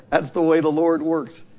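ordinary talk, nothing marked

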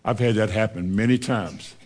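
ordinary delivery

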